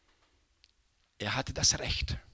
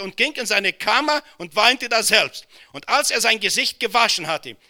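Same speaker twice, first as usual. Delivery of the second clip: high, loud preaching at full force; rising sermon fervour; fiery preaching; 7 semitones higher, 6 dB louder than usual. Acted. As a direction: preach it out to the whole hall. Preaching Joseph's story with force.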